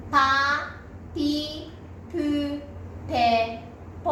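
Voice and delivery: パ, ピ, プ, ペ, ポ as separate held syllables; syllable drill. Shown as a demonstration. Teacher leading learners through パ to ポ, separated P syllables. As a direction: model the P row from パ to ポ for repeating. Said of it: "皆さんも言いましょ".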